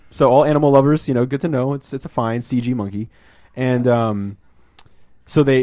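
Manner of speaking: ordinary talk, nothing marked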